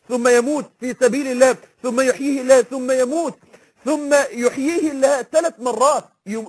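ordinary delivery

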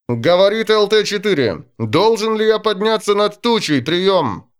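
muffled pilot on the radio; pilot over the radio. Acted. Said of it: "послышался приглушённый голос пилота".

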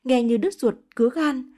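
plain reading voice

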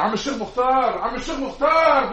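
high loud pleading cries after him; desperate calling out. Acted. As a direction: shout after him, begging him to take it. Begging the departing sheikh. (نداء عالٍ متوسل)

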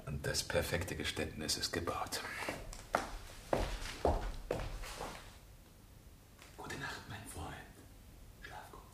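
soft, fading whispered farewell; a pleased murmur that dies away; gloating whisper. Acted. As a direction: whisper it over the body, fading to almost nothing. Villain alone after the murder.

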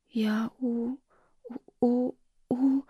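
запинаясь, тише и выше; выдавливай звук, застревая; заикание. A narrator's reading. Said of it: "не удавалось выдавить из себя слово". (дочь в шоке, заикаясь, не может выговорить слово)

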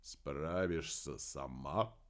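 low, slow, soft amazed question; genuine surprise. A narrator's reading. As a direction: draw it out in quiet disbelief. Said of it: "непритворно удивился Дер Дагентур". (удивлённо, тихо и медленно)

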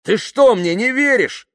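high, indignant shout; yelling. Acted. Yuklin demanding that Kaftanov believe him, yelling. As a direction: shout the question in outrage.

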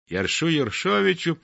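ordinary delivery, nothing marked

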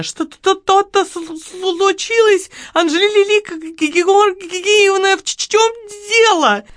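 put-on high, halting, stammering shop girl; squeaky stuttering plea; terrified stutter. A narrator's reading. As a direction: fight to get each word out in fright. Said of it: "произнесла почти членораздельно".

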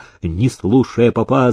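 ordinary delivery, nothing marked

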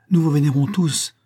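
cried out high and loud; loud devoted outcry; fervent cry. A narrator's reading. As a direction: barons swear devotion at her feet. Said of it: "s'écrièrent les barons".

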